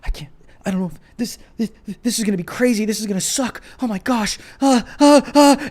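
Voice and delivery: fast, breathless imitation of someone freaking out; mock panic. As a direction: act out a nervous panic, rushing the words. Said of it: "and you're like".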